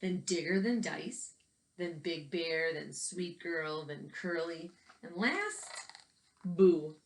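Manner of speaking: put-on gentle storytelling read for young listeners; picture book narration. A narrator's reading. Teacher reading a picture book to children, storytelling voice. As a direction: read the book aloud to the kids, evenly paced.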